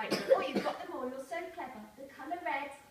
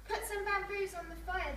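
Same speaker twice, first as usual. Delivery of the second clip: firm order; 6 semitones higher than usual. Acted. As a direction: bark it out to the villagers. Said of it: "the old man ordered".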